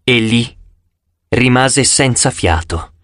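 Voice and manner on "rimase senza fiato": ordinary delivery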